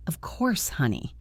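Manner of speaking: breathy hushed whisper to her husband; wife whispering a falsely sweet reply to her husband. Acted. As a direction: whisper it sweetly so only he hears. Wife fakes sweetness to cheating husband.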